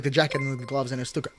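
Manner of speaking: ordinary delivery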